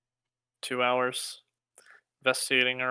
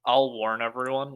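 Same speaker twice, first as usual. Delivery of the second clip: ordinary talk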